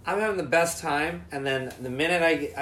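ordinary talk

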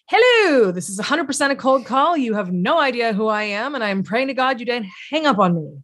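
high sing-out hello, then playfully pleading; charming awkward pitch. Acted. Cold caller owning up to a stranger, charmingly awkward. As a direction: lean cheerfully into the awkwardness. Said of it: "He's charming because he leans into the awkwardness".

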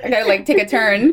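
normal talking voice

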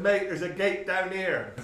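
laughing. Retold or imitated. Bloke points out gate.

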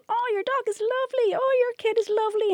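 very high, sing-song gushing. Retold or imitated. Chatting with a dad in a cafe, high gushing coo. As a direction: coo over the dog and the kid.

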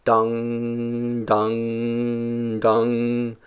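slow, ringing dung of a distant bell; buoy bell. A narrator's reading. Narrator evoking the buoy in the distance, slow tolling. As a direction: toll each dung slowly like a buoy.

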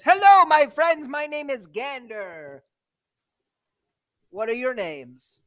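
booming, high hello that settles down; dwarf. Acted. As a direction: hail them heartily as a dwarf trader. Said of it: "It's led by a dwarf who says".

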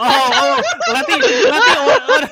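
excited. Real, not acted. Excited realisation.

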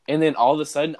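normal talking voice

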